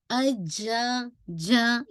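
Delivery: अजा slow with audible air; jeem too far back. Shown as a demonstration. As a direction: pull the tongue back, let air out. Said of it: "ये आ, आ रही air". (पीछे से अजा, हवा के साथ)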